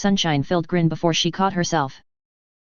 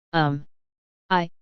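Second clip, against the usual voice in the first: stammer. A narrator's reading. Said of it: "Toby stammered".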